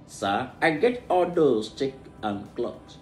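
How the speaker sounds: ordinary talk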